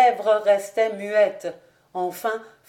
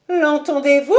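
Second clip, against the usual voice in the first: high, loud exclamation; outraged cry; 6 semitones higher, 8 dB louder than usual. A narrator's reading. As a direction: call everyone to witness the outrage. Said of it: "s'écria Lady Ashton". (cri indigné, aigu et fort)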